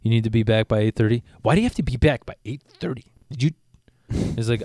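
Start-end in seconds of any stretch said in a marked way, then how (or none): 1.4-3.5 mock a bratty kid questioning the rule; swooping, incredulous kid impression; put-on voice of a spoiled kid challenging a parent. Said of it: "that's how he talks all the time".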